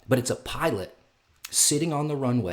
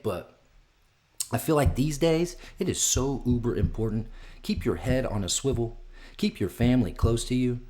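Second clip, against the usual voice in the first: normal talking voice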